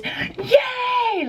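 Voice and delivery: high joyful squeal; excited yell. Retold or imitated. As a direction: shriek with delight. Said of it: "she yelled in the car".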